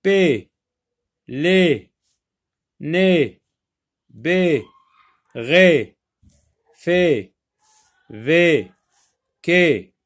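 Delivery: loud, separate syllables with a pause after each; syllable drill. Shown as a demonstration. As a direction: read each syllable alone like a phonics drill. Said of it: "on va combiner".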